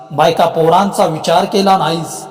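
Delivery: ordinary talk, nothing marked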